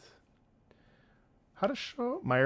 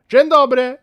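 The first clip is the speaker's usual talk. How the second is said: "Dzień dobry" said higher and louder; louder greeting; 6 semitones higher, 10 dB louder than usual. Shown as a demonstration. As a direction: call out "Dzień dobry" brightly.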